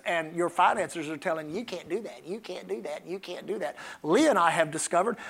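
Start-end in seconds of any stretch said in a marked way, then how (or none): 1.6-3.8 low, very soft mocking repetition; hushed nagging. Finances telling a believer what they can't afford, hushed nagging. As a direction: drop it right down, like a nagging inner voice.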